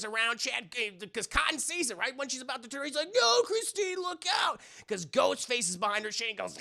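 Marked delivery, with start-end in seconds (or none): 3.1-4.6 high, loud mock shout; hammed-up frantic warning. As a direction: yell a warning. Warning her of the killer.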